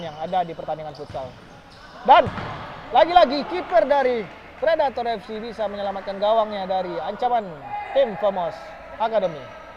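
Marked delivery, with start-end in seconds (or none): 2.0-9.6 mock sports commentary. Real, not acted. Live futsal play-by-play.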